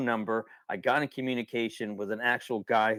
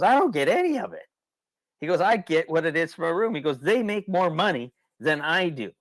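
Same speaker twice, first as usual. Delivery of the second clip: imitating the owner; 7 semitones higher, 4 dB louder than usual. Cabin owner complaining, retold.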